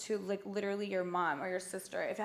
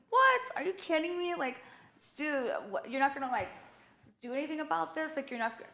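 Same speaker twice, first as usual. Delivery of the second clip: loud, higher incredulous what, then cooling; scoffing at the store's cinnamon twist offer, indignant; outraged disbelief; 6 semitones higher, 6 dB louder than usual. Retold or imitated. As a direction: throw it back at them like you can't believe it.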